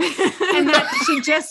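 words stretched slow through laughter; laughing slowly. Real, not acted. Teasing sister to friends, slow laughing. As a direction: laugh while dragging out "that".

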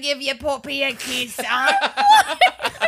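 a mock Latin dad calling his boy; hammed-up Hiram. Hiram calling his son over, hammed-up Latin dad.

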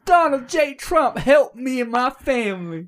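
high, slow, pleading ad voice; pleading woman voice. Retold or imitated. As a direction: beg like a devoted supporter. Imitating the woman in the ad.

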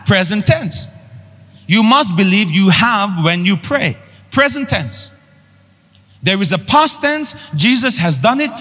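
high, ringing pulpit voice climbing with each line; full-force sermon delivery; fiery preaching. Acted. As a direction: preach it out to the whole church.

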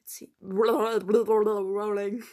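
laut lachend, sehr langsam. Real, not acted. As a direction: bricht beim Vorlesen in Lachen aus. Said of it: "lacht beim Outtake". (lachend über den eigenen Versprecher)

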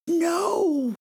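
high, loud panicked yell; roar. A narrator's reading. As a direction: yell it to stop him. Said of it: "he roared again".

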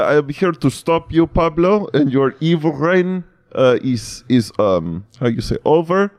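high, halting put-on accent; mock Polish Bond. Polish Bond confronts the villain Pablo, high mock accent. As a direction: fumble the words in a high foreign accent. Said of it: "He's like".

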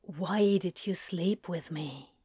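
soft, hushed question; understated whisper. A narrator's reading. Demon asks angel why they slept together, hushed. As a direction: ask it softly, almost under the breath. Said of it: "he whispers".

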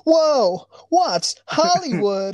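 put-on high, slow, awestruck kid voice; wide-eyed infomercial sidekick. Sidekick marvelling to the host in a mock infomercial, high and slow. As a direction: gush like a naive sidekick on an eighties tape. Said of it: "infomercial style".

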